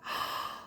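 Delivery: acted breathy gasp of disbelief; shocked gasp. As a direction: gasp as if stunned. Acts out seeing the double.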